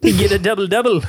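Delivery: mock Canadian; Canadian accent. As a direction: play up the Canadian English. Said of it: "Canadian English".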